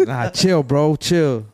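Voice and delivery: laughing fake protest; frat bro. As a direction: bro pleading through a laugh. Faking getting punched.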